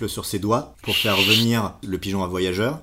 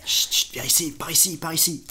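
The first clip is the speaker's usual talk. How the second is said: higher, hushed urgent calling; hushing the bird; 8 semitones higher than usual. Acted. Flantier hushes the pigeon into the corner, high and urgent. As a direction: wave the bird into hiding.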